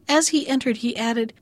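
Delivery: ordinary delivery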